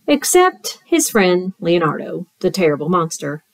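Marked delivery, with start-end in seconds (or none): none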